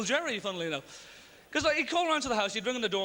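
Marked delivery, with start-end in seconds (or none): none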